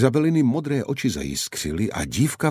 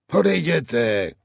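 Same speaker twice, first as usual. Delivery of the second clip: cracked shout; 8 semitones higher, slower than usual. A narrator's reading. The guard on the wall yells the riders away, cracked shout. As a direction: shout odejděte up at them in a cracked voice. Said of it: "křičel muž nakřáplým hlasem".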